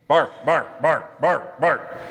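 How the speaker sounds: woofy mock barks; playful imitation of a big dog barking. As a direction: bark it out like the German Shepherd.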